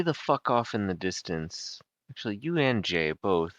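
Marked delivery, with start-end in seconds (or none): none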